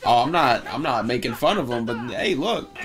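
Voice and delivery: usual speaking voice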